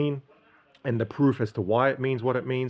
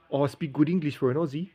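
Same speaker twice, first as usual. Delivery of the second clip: a light South African accent put on; lightly mimicking a South African man; 5 semitones higher than usual. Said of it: "That's what one South African said to me once".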